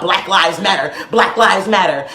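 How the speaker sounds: low, dismissive parroting of a slogan; mock chant, pitched well down. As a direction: drop the pitch and parrot it wearily. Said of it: "people talking about".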